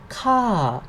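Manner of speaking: "ka" high, then gliding down; falling tone. Shown as a demonstration. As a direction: start "ka" high and let it drop. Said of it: "falling sound".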